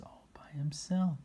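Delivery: breathy storybook whisper. Real, not acted. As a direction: whisper it like a secret. Narration suddenly whispered.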